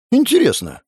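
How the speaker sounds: high-pitched, put-out objection; protest, pitched right up; indignant. A narrator's reading. Shumov objecting to looting the dead, indignant. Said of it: "возмутился Шумов".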